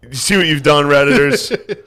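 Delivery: mock scolding. Real, not acted. Scolding the Redditors for making friend cry. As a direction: scold the crowd theatrically. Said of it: "addressing Redditors".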